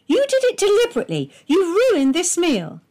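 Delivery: high, loud, fast tirade; a high, loud, rushed furious charge; angry accusation. A narrator's reading. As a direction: rattle it off at him in a fury. Wife repeats accusation.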